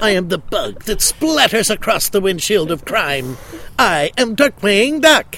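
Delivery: Darkwing Duck's grand intro. Acted. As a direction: boast like a caped cartoon duck. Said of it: "I'm crazy about Darkwing Duck".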